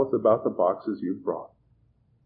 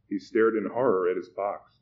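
usual speaking voice

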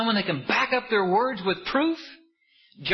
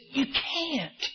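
protesting crowd; 6 semitones higher than usual. Retold or imitated. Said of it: "They said".

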